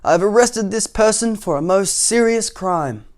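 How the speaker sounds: pompous high-pitched soldier's report. Acted. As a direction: announce the charge grandly to the king. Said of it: "said the soldier".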